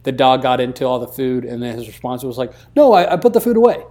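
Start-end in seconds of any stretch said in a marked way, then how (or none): 2.7-3.8 put-on high, loud, fast kid's excuse; little kid excuse. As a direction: blurt it like a caught child. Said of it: "like a little kid who'd forget".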